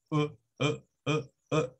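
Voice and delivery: "E" as a short neutral "uh"; brief low "uh" grunts for "E"; schwa sound. Shown as a demonstration. Teacher isolates the schwa for students, short and weak. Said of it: "Es el sonido que se debe de hacer".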